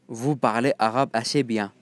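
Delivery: normal talking voice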